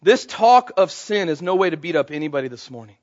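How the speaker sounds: usual speaking voice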